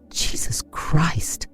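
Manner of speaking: slow whispered curse; sworn under breath. A narrator's reading. Man hearing the girl's news, cursing in a whisper. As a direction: swear it under your breath. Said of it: "The man swore under his breath".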